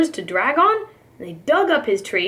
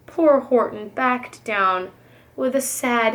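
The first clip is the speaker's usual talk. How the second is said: ordinary delivery, nothing marked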